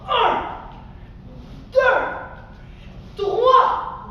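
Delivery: high, forceful spaced-out count; shouted count. Shown as a demonstration. Teacher counting three stomps on the cloud, shouted. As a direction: call each number out with a stomp. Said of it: "Tu dis un, deux, trois".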